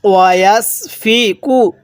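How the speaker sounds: ওয়াসফিকু recited slowly, drawn out; slow recitation. Shown as a demonstration. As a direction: chant it slowly for students to repeat. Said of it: "এরপর বলুন".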